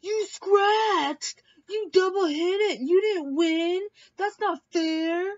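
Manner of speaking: high, loud mock whine of a sore loser; whiny sore loser. Mocking a sore loser at a drinking game, high, loud whine. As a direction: whine it out like a kid who lost a game.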